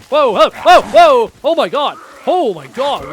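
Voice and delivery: loud, high startled yells. Real, not acted. Ambushed by three enemies in game, startled shouting. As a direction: cry out in alarm as if ambushed.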